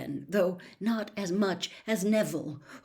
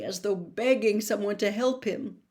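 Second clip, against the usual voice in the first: plain reading voice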